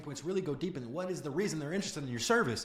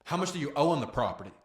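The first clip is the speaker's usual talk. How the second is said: firm, louder, unhesitating question; direct question; 6 dB louder than usual. Shown as a demonstration. Coach showing a direct question to a seller, firm. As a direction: ask it straight out with confidence. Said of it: "That sounds completely different than".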